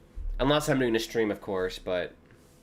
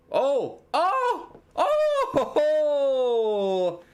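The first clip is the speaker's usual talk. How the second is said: excited shouts; 4 dB louder, much slower than usual. Real, not acted. Big chance in the match.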